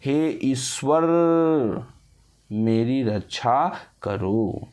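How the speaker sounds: हे ईश्वर मेरी रक्षा करो slowly, vowels drawn out; prolonged invocation. Shown as a demonstration. Teacher demonstrating a call to God, हे ईश्वर मेरी रक्षा करो prolonged. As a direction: stretch each word of हे ईश्वर मेरी रक्षा करो, pausing after Ishwar. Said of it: "इस प्रकार का ये ध्वनि".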